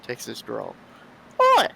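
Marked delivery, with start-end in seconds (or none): 1.4-1.6 high squeaky Australian hamster yelling; a tiny hamster in an Australian accent shouting to be let go. Acted. Hamster yelling at the man grabbing him, high squeaky Australian accent. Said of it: "That was Australian".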